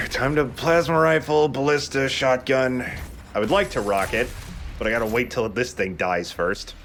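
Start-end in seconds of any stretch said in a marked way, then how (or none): 0.0-2.9 higher-pitched mock game plan; acted inner monologue. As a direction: list the weapons as your own thought, pitched up. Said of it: "I'm like".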